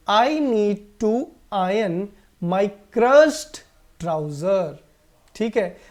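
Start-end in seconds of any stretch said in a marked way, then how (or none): 0.1-4.7 very slow, word by word; slow model sentence. Shown as a demonstration. Teacher reads the example sentence to the class very slowly. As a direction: dictate it slowly. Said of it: "sentence देख लीजिए".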